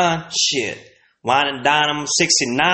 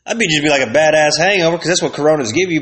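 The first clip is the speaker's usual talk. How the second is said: ordinary talk, nothing marked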